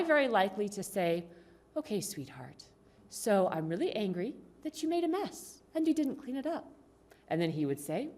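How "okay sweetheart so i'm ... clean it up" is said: sugary, soft, smiling complaint; sweetly nice. Acted. Wife to husband about the mess, sweetly nice. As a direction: say the anger far too nicely. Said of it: "We don't say it nicely like that".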